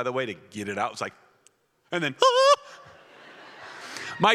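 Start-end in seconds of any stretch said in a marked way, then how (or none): 2.2-2.6 shrill terrified scream, very high and loud. Retold or imitated. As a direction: let out a sudden terrified shriek. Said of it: "And I screamed so loud".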